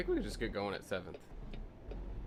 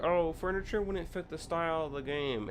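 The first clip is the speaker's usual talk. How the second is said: higher, sing-song mock of a dismissive commenter; mocking quote; 7 semitones higher than usual. Mimicking forum users dismissing furniture, snooty mock voice. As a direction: parrot their excuse in a snooty voice. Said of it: "they were like".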